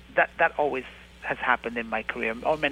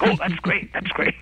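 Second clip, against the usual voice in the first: high, eager mock producer enthusiasm; mock gushing; 6 semitones higher than usual. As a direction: gush like a delighted producer. Producers gushing over the pitch.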